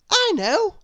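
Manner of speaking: high, bright-eyed know-it-all cry; eager little girl voice. A narrator's reading. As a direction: pitch it way up, keen to answer. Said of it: "said little Miss Brainy".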